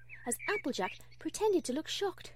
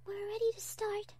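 high, soft, timid voice; Fluttershy; 9 semitones higher, 5 dB quieter than usual. A narrator's reading. Fluttershy telling Applejack they're ready, timid and soft. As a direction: softly let a friend know, high-pitched. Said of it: "said Fluttershy".

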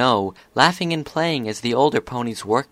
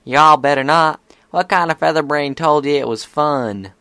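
loud, higher scolding; stern big sister; 5 semitones higher, 7 dB louder than usual. A narrator's reading. Big sister warning fillies off bungee jumping, stern. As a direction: scold them like a protective older sister.

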